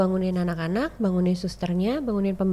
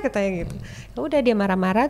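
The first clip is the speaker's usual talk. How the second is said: ordinary talk, nothing marked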